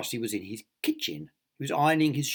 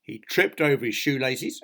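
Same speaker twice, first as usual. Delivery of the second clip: plain reading voice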